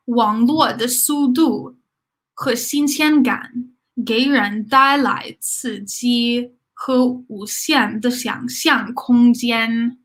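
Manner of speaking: slow, careful read-aloud; teacher reading slowly so learners can repeat. Shown as a demonstration. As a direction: read it slowly for students to follow. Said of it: "We'll do this one slow again".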